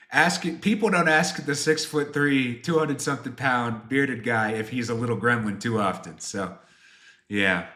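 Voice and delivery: ordinary delivery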